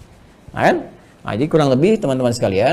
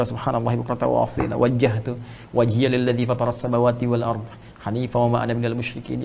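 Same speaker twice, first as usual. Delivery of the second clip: devout prayer; 2 semitones lower than usual. Acted. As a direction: recite and pray in hushed surrender. Recites opening prayer, translates.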